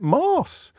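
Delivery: high-pitched "Mass" like "Mars"; long-A Mass. Shown as a demonstration. Nobody outside his school says it, incredulous long-A "Mass". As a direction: draw "Mass" out as "Mahss", way up high. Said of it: "who calls it".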